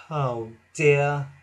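sigh, then a downcast "oh dear"; resigned, sighing; dejected thought. A narrator's reading. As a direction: sigh out the disappointment. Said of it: "thought Thomas".

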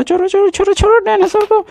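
very high, fast squeal; frantic mock plea. Play-acted frantic plea during toy fight. (बहुत ऊँची, तेज़ आवाज़ में बनावटी घबराहट)

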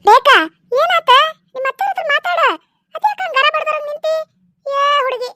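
high, animated reproach; chiding prod. Acted. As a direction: nudge a frozen girl into talking. Elder prods the frozen young woman.

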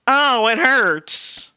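high, slow mock whimper; mocking whine. Streamer whining after getting mushed, high mocking whimper. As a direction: whine it like a hurt crybaby. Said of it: "see how he switched up".

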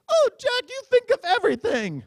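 high, loud, gushing falsetto; swooning wife voice. Retold or imitated. Wife swoons at her husband on phone, high falsetto. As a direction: gush at him in a high adoring squeal. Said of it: "And then she said".

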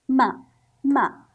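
short, loud "ما"; short vowel. Shown as a demonstration. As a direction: say "ما" with a quick, punched vowel. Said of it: "صوت قصير وصوت طويل". ("ما" بصوت قصير وقوي)

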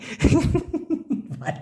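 soft unvoiced giggle at his own slip; airy laugh. Real, not acted. Teacher laughs at mispronouncing the word, soft giggle. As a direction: laugh it off softly.

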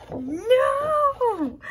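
high, loud drawn-out squeal; overjoyed disbelief. Real, not acted. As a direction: cry out in delighted shock. Reaction to revealed print.

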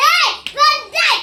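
high, very loud drawn-out yell; defiant shout. Real, not acted. Child calls the ghost a pig, shouting. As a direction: shout the insult at full volume.

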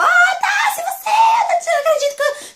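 high, loud laughing squeal; fangirl squeal. Retold or imitated. Fan hugging her idol in the food court, squealing. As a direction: shriek with laughing disbelief. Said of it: "Gente, eu gritei".

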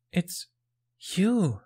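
high, halting amazement; breathed. A narrator's reading. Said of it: "he breathed".

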